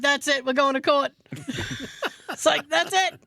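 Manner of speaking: laughing mock outrage, going to court. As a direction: joke threat through laughter. Joking threat to go to court.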